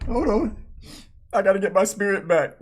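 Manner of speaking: usual speaking voice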